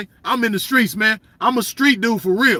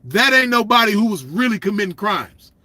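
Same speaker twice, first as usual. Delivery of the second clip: ordinary talk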